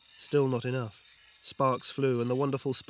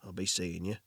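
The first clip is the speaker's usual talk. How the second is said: low gruff voice=67; 5 semitones lower, 4 dB quieter than usual. Acted. Victor leaving.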